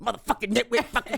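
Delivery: back to Mad Max. Retold or imitated. Dad hanging up and cursing again, mock furious. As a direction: snap back into rage after hanging up. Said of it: "become Mad Max again".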